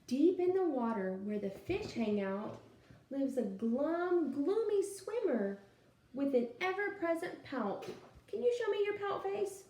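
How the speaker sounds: lively storytime read-aloud; animated picture-book reading; reading to children. Acted. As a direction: read it out to a class of little kids.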